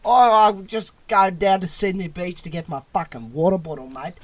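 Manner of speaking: broad Crocodile Dundee Australian. Shown as a demonstration. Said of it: "that is a very stereotypical Australian accent".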